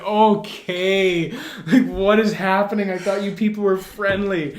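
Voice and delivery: loud, high-pitched retold disbelief; hammed-up "what is going on" outcry; mock bewildered protest. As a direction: exclaim it as exaggerated bafflement. Acts out own startled protest.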